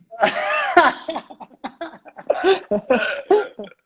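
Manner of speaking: a big real burst of laughter. Real, not acted. Telling a mate about nights out, bursting into real laughter. Laughing at his own story.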